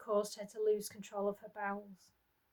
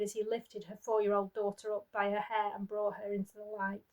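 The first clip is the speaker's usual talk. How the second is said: usual speaking voice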